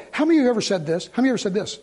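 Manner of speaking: ordinary talk, nothing marked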